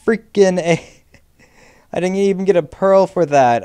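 very high, loud indignant cries; outraged yelp. Real, not acted. Gamer yelping as an Enderman hits them.

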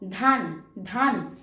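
ধান said slowly and firmly with breathy ধ; clear demo of a letter sound. Shown as a demonstration. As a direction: stress the aspirated ধ in ধান. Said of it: "এটা হলো দোয়েল".

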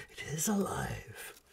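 It is alive breathed out as a proud, unvoiced whisper. A narrator's reading. Baron shows off his living machine, proud whisper. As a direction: hush it with pride, is leaned on then falling away. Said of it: "Baron Callan whispered proudly".

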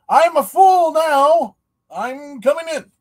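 high, drawn-out comic yell; hammed-up holler. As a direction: call it out big and high, mimicking a stand-up bit. Acts out the quoted comedy bit.

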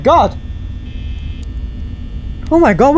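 breathless dread. Real, not acted. Scared by game event.

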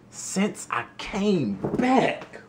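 high, slow, rising disbelief; incredulous echo. Retold or imitated. Repeating the news to himself, slow stunned disbelief. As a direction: stretch it out like you can't believe it.

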